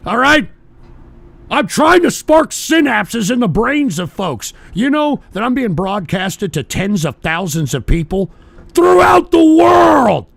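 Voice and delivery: high, loud enraged yelling at the chat; furious shouting. Real, not acted. Streamer screaming at a chatter accusing fake choking, furious.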